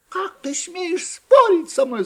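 high, slow indignant shout. A narrator's reading. Mouse shouting at her arguing friend, high and slow. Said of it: "закричала Остроноско".

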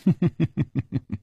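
scornful villain laugh; loud laugh. Acted. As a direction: laugh mockingly. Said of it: "大笑地说".

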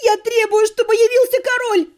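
high, loud hysterical yell; girl screams for the king amid the chaos; shouting demand. A narrator's reading. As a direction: demand the king at the top of her voice. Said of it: "закричала я".

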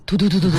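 rapid repeated gun-burst syllables; imitated machine-gun fire.

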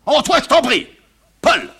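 high, loud exasperated father; irritated rebuff. Acted. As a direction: shut her interference down loudly. Father rebuffs his wife.

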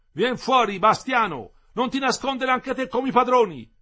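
high, loud shout from the street; shouted challenge. A narrator's reading. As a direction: yell up at him to come out. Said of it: "Aminta gridò".

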